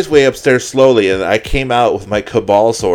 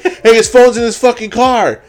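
high, loud call to friends outside; 14 semitones higher, 6 dB louder than usual. Retold or imitated. Friends outside calling about the phone, loud and high.

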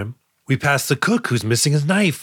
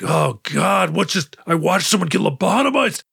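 higher, mock-horrified gasp of disbelief; pretend horror; 5 semitones higher than usual. Voicing what the doctor should say, mock horrified. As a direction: act out the shock she should have felt. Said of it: "she should be like".

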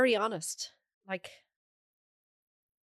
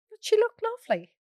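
ordinary talk, nothing marked

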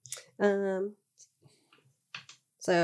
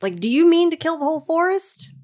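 high, loud alarmed rebuke; alarmed scolding; 11 semitones higher, 5 dB louder than usual. Acted. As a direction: snap at her to stop.

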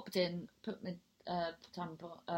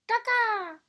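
high, loud mock bird squawk; bird call; 8 dB louder than usual. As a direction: squawk a silly signal call. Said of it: "do a call for you".

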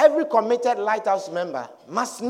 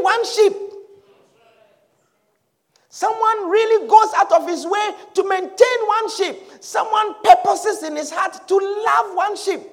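high, forceful pulpit delivery; urgent sermon pitched well up; impassioned preaching; 8 semitones higher, 7 dB louder than usual. Acted. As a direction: preach with rising fervour, leaning on key words.

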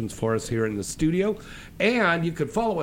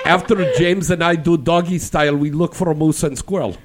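hammed-up Russian accent, high and loud at first; playful fake Russian, slipping toward Irish; mock Russian accent; 5 semitones higher, 6 dB louder than usual. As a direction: do a bad cartoon Russian accent. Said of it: "he's trying to sound Russian".

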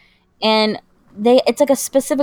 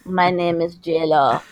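put-on low, slow Russian-accented actress; 5 semitones lower, 3 dB quieter than usual. Host mimicking actress J-Law's funny accent, Russian accent. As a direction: put on a thick-tongued Russian accent, drawn out. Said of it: "With her Russian accent".

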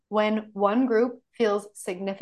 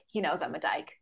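inner thought; 6 semitones lower, 8 dB quieter than usual. Retold or imitated. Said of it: "in my head".

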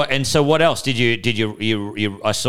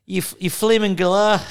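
put-on high-pitched, botched Kiwi accent; attempted New Zealand accent; 9 semitones higher than usual. Friend botching an impression, attempted New Zealand accent. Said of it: "trying to do a fucking New Zealand accent".

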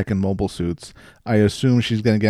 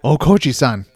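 put-on Japanese accent; 4 semitones higher, 5 dB louder than usual. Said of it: "That's how you pronounce it".

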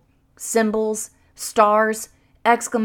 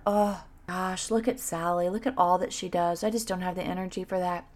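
envious inner voice; 3 semitones lower, 8 dB quieter than usual. Retold or imitated.

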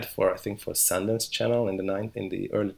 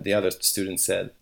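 normal talking voice

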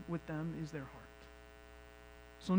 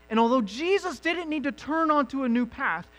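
high, loud impassioned preaching; fervent pulpit delivery, voice raised high; full-force preaching; 6 semitones higher, 8 dB louder than usual. Acted. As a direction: lift it up and drive each question home to the congregation. Preaching rises to a peak.

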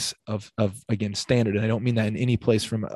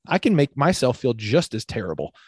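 usual speaking voice

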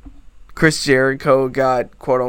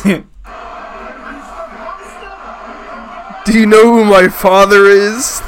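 loud, high entitled shout; drunken posh sneer; 7 semitones higher, 5 dB louder than usual. Acted. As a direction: shout it like a spoiled drunk aristocrat. Mock entitled arrest protest.

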